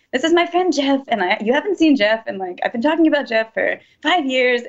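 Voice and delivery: high-pitched retold scolding at normal volume; indignant mock yell, pitch leaping up; imitated yelling. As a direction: mimic an exasperated roommate's rant. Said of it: "he kept yelling at me".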